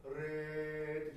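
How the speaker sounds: レー dragged out slowly as one level syllable; wrong drawn-out syllable. Shown as a demonstration. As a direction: stretch レー without letting a clear "e" emerge. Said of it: "的な感じに聞こえてしまうと".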